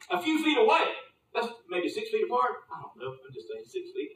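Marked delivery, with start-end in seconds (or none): 2.7-4.1 whispered aside. Real, not acted. Whispered aside about distancing.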